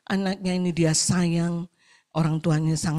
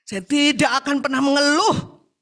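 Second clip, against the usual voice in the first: louder, higher acted resolve; emphatic vow; 7 semitones higher, 6 dB louder than usual. Repentant visitor vows never to complain, loud and emphatic. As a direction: swear it forcefully, pitch raised.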